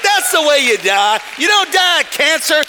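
loud, high, rhythmic preaching; preaching at full force. Real, not acted. Preacher driving faith home to the congregation, shouting. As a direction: shout it to the back pews.

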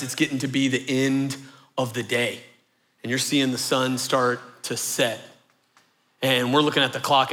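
ordinary talk

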